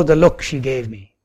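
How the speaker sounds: ordinary talk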